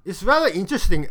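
ordinary talk

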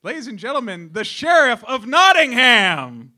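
big loud slow announcer intro. Acted. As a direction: boom out the guest's grand entrance. Announcing the famous guest.

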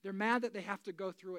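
ordinary delivery

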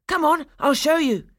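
put-on high, loud child's voice; eager boy. A narrator's reading. Fritz leading the children to the gifts, eager boy. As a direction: urge them on, high and loud. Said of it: "said Fritz".

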